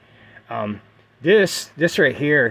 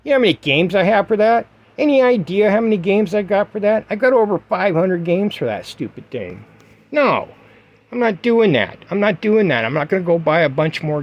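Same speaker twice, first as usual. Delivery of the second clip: indignant outburst; 6 semitones higher than usual. Real, not acted. Indignant rant about buying games.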